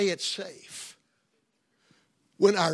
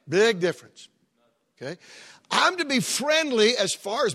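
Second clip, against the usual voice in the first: punched out, much louder; emphatic loud; 6 semitones higher, 9 dB louder than usual. Acted. Preacher drives home the point to his flock, loud. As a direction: slam the two words down.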